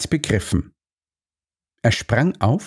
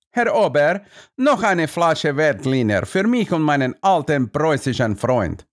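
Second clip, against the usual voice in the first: calling; 5 semitones higher than usual. A narrator's reading.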